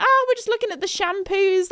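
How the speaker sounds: high, airy, unbothered reply; super chill. Retold or imitated. Trapped friends on the phone, super chill. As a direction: play it breezily oblivious. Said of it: "super chill about it".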